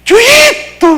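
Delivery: very high, loud mock wail; imitated wailing shout. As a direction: cry out like the grieving aunt, high-pitched. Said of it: "alguien me abraza gritando por la espalda". (grito agudo de lamento, imitado)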